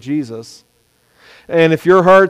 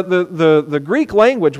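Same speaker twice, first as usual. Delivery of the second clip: usual speaking voice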